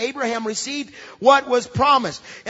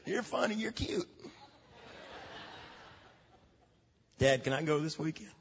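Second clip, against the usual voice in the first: soft wheedling; 5 semitones lower, 12 dB quieter than usual. Retold or imitated. Kid sweet-talking dad.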